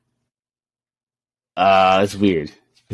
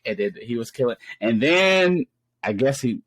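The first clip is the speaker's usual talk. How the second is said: ordinary talk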